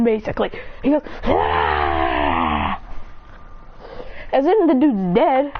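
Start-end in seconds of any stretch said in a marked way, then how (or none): 1.2-2.8 long, rasping breathy scream; attack scream. Acted. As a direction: sustain a rough exhaled battle roar. Acted electrocution attack.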